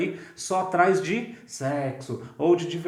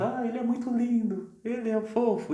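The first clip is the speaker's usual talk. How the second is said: much higher gushing parent voice; doting parent; 8 semitones higher than usual. Retold or imitated. Proud parents gushing over their baby, high and doting. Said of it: "as mães e os pais".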